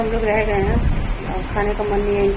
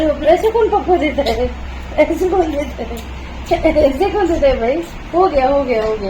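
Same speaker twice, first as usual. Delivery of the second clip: laughing exclaim; 5 semitones higher than usual. Real, not acted.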